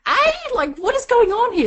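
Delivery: mock perplexed disbelief. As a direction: act totally baffled at her reaction. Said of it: "So I was absolutely perplexed".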